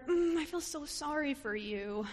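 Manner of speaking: sorry-for-you tone, a touch put on; condescending pity. Preacher voicing an unsympathetic Elizabeth, mock pity. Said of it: "She doesn't show sympathy".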